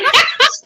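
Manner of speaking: high cackling laugh; witch-like cackle; witchy cackle. Real, not acted. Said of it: "I do sound like a witch when I laugh".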